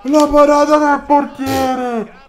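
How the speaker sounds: high, loud, slow save call; excited commentary call. Pretend. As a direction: shout the save like live TV.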